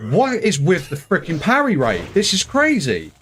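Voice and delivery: loud, high exasperated outburst; what is this, incredulous. Real, not acted. Frustrated gamer reacts to parry.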